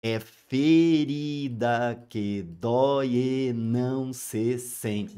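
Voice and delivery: very slow, measured verse; slow syllable reading. Shown as a demonstration. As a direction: read the verse slowly, counting syllables. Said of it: "Dez sílabas poéticas". (leitura bem lenta, contando sílabas)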